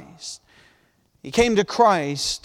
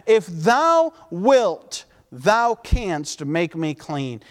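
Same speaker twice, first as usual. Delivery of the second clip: slow pleading quote; 3 semitones lower, much slower than usual. Retold or imitated. Preacher voicing the leper's plea.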